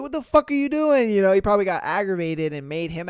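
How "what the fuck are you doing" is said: high, loud furious yell; mock outraged shout; angry yell. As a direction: yell at him in disbelief. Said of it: "He was like".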